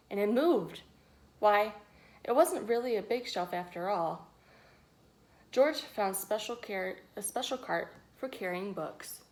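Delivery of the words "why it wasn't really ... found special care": plain reading voice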